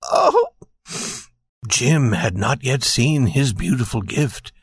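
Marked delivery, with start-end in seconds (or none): 0.0-0.5 very high, sudden exclamation; excited cry. A narrator's reading. Wife jumps up remembering his gift, crying out high. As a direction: cry out in a high-pitched wife's voice. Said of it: "she jumped up and cried".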